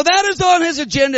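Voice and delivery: full-force revival declaration; prophetic preaching. Retold or imitated. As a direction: proclaim it like a prophet. Continuing the prophecy.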